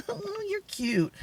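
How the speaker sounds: light giggle into soft, high, drawn-out coo; mildly flirtatious. Retold or imitated. Phone seller answering a customer's remark, mildly flirtatious. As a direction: coo it high and slow, playfully imitating your flirting. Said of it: "When I say flirting".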